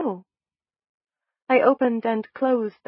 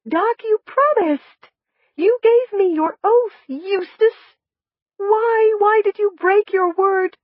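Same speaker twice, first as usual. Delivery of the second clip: much higher and louder, slow, wounded; high hurt accusation; 12 semitones higher, 7 dB louder, slower than usual. Acted. Blaming Doc for breaking his oath, high and hurt. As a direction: reproach him with a child's fragile outrage.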